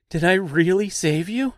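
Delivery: high, incredulous rising question; amazed, pitch leaping up in disbelief; astonished. A narrator's reading. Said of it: "he asked in complete astonishment".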